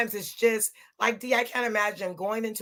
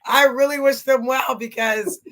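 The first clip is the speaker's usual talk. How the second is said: higher, louder, breaking into laughter; laughing; 7 semitones higher, 4 dB louder than usual. Real, not acted. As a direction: try to insist through real laughter. Laughing too hard to finish.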